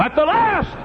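high, loud preacher's cry; shouted refrain. Real, not acted. Preacher warning sinners of the end, sudden shout.

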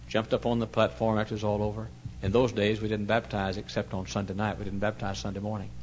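ordinary talk, nothing marked

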